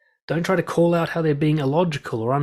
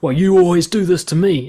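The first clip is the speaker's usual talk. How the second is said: louder, higher, a hurt accusation; mock accusation, clearly louder; accusing whine; 4 semitones higher, 8 dB louder than usual. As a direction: play the blaming partner, raised voice. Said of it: "Be like".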